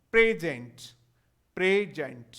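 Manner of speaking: प्रेजेंट said "PRE-jent", open e first; adjective pronunciation. Shown as a demonstration. Teacher showing students the adjective's sound, प्रेजेंट as "PRE-jent". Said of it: "adjective के रूप में".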